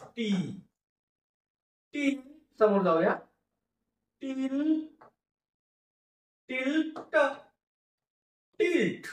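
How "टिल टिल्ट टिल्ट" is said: टिल and tilt drawn out, blending up to the whole word; slow sounding out. Shown as a demonstration. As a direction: build tilt up piece by piece. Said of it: "उच्चार करताना तो सलग".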